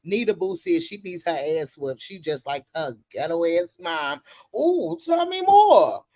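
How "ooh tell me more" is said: drawn-out, loud, high ooh tell me more; milk it, eager for the tea; slow excited tease, louder and higher; gossip-hungry ooh. Real, not acted.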